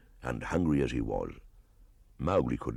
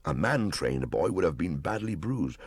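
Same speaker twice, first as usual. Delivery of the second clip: ordinary delivery, nothing marked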